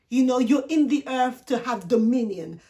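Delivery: normal talking voice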